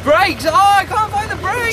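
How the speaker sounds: high-pitched yell of alarm; panicky, high calling out; panicked shout. Acted. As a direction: cry out as the bike runs away from you. Rider panics, can't find brakes.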